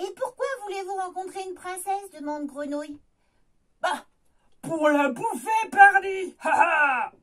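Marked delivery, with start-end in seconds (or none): 3.8-7.1 loud ogre gloat. Acted. Ogre gloats about eating princess.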